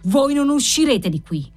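high, furious threat; furious yell. A narrator's reading. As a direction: bar the door with rage in the voice. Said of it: "urlò il marchese Cavalcanti".

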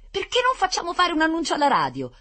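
high, loud naive suggestion; candid little boy. A narrator's reading. Blond boy suggesting a radio announcement, candid. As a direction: propose it with childlike candour. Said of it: "propose candidamente il biondino".

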